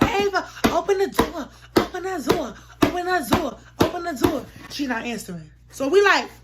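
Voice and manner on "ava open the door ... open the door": high, raised repeated chant-like yell; re-enacted urgent hollering; yelling demand. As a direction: pound out the demand at a locked door. Yelling at a locked door.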